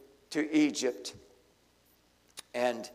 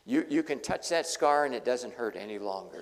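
ordinary talk, nothing marked

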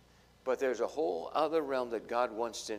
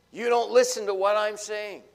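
frustrated complaint; 6 semitones higher, 9 dB louder than usual. Retold or imitated.

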